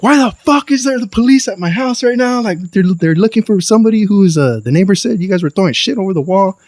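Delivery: high-pitched, indignant scolding; angry mother on phone. Retold or imitated. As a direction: imitate an upset mom demanding answers. Retells mother's angry phone call.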